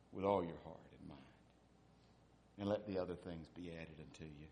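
soft, quiet, earnest; quiet reverent voicing of God; gentle voice of God. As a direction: speak it tenderly, low and soft. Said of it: "Then God says".

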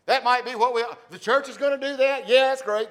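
hammed-up dismissive churchgoer; mock excuse. Pastor lampooning a church member, high and loud.

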